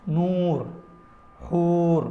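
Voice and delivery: plain, straight long oo in نور، حور; clear long oo. Shown as a demonstration. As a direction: hold a pure oo in نور and حور. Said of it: "صاف، سیدھی سیدھی".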